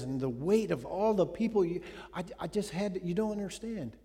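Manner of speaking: mock-pleading excuses after being caught in sin; flustered excuses. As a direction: babble defensively like someone caught out.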